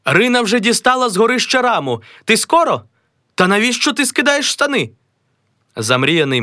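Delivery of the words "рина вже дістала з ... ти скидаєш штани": high, surprised scolding; a high, flustered wife; startled wife. Acted. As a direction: catch him undressing.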